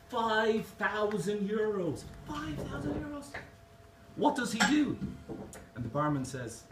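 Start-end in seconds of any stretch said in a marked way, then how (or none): 0.1-2.0 high, slow, stretched-out price; drawn-out reveal. Acted. Barman revealing the third parrot's price, drawn out. 2.3-5.0 very high squeal of mock outrage; incredulous customer. Irish customer demanding what the dearest parrot does, incredulous. Customer shocked at biggest price.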